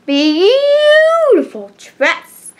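"beautiful" held very long and high; gushing emphasis. Acted. Reader describing the girls' big dresses, gushing on "beautiful".

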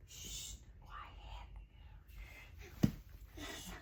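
long shush, then stretched whisper; whispered hush. Real, not acted. Hushing a noisy baby near sleepers, drawn-out whisper.

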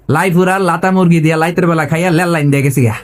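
every R-word starts with L, "লাইপুরা লাতা"; mock Raipura sentence, "লেল লাইন" for rail line; R becomes L. Preacher imitates Raipura locals, "লাইতের" with L for R. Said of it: "ররে কি বলে".

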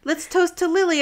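high, loud mock toast; eager toast. Smitten hero toasts his girlfriend, mock eager toast. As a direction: propose it loudly, like a smitten boyfriend.

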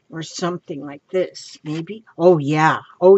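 ordinary delivery

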